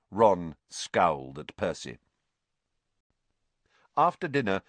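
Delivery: usual speaking voice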